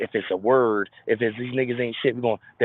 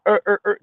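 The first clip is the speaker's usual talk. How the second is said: mocking grunts; 7 semitones higher, 6 dB louder than usual.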